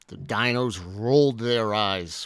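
ordinary delivery, nothing marked

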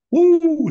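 loud, high-pitched whoop; sudden loud falsetto-like whoop; whooped "woo". Real, not acted. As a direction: let out a big high "woo". Exclaims over broken truck A C.

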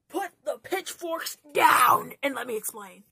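playful yelling over an imagined mob; mock defensive shout. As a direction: shout it out word by word, hammed-up. Pleads with imagined angry mob.

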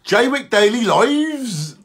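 like Suffolk. Shown as a demonstration. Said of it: "say it like you would say Suffolk".

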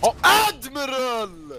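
drawn-out, high shouted name; long loud cry of dismay; exasperated shout. Real, not acted. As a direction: holler your teammate's name, stretching it out. Calling out teammate in dismay.

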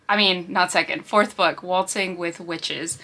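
usual speaking voice

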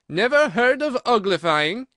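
loud, high incredulity; surprised exclamation. A narrator's reading. Gryphon amazed at Alice's ignorance, loudly incredulous. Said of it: "lifted up both its paws in surprise".

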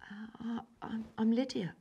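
soft stammering whisper; frightened mutter. A narrator's reading. Scared child naming herself to the wild girl, whispered. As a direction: stammer it out under your breath. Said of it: "I muttered".

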